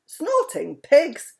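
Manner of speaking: high, loud, slow startled cry; cry of surprise. A narrator's reading. Mister Wumble seeing the parrot holding his hat, a loud surprised cry. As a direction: blurt it out in surprise. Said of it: "cried Mister Wumble".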